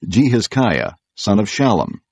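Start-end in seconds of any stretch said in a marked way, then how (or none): none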